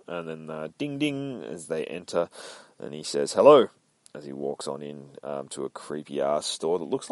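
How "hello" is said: calling out hello. Acted. Calling into empty store.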